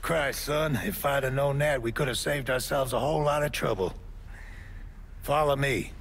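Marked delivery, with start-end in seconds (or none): none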